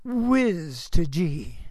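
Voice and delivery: slow, deliberate "whiz to gee"; slowly spelling out the joke; slow emphasis. Shown as a demonstration. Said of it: "I'd always add the word".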